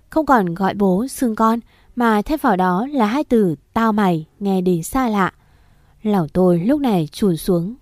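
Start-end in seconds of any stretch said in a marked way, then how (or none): none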